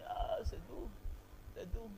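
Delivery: breathy hush, drifting toward sleep; soft and soothing. Shown as a demonstration. Singing teacher demonstrating, in a hushed soothing voice. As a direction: soothe the listener almost to sleep. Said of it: "vous pouvez même dormir".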